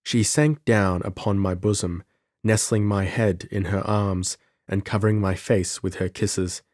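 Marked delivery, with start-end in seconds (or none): none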